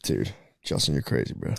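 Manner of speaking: hushed, breathy aside to a friend; teasing whisper at Justin. Acted. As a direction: drop to a whisper, almost unvoiced.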